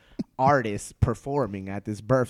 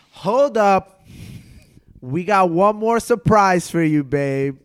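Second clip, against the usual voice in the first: loud, higher retold announcement; calling for attention; 6 semitones higher, 11 dB louder, slower than usual. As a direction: imitate a hostess cutting in loudly to announce. Acting her announcement to the room.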